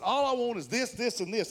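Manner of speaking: loud, high, emphatic order; exasperated ordering. Acted. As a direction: spell it out impatiently for the drive-thru. Acting out exasperated drive-thru order.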